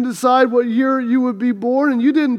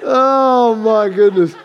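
louder, still laughing; laughing exclamation; 5 dB louder, slower than usual. Real, not acted. Preacher recovering from his own joke, laughing. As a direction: shake it off while still laughing.

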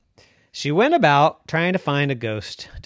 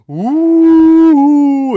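ghost moan; 11 semitones higher, 12 dB louder than usual. A narrator's reading. Ghost moan scares visitors.

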